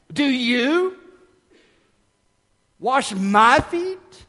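high, stretched rise on "you", long pause, "my" hit hard; astonished question leaning slowly on "you", then on "my"; YOU and MY drawn out high, split by a big pause; stress on YOU and MY. Shown as a demonstration. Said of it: "pour great intentional emphasis into Peter's use of pronouns".

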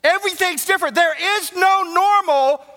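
high, loud climactic pulpit cry; full-force preaching. Acted. Preacher telling congregation everything is different, full-force preaching. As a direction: build to a ringing proclamation.

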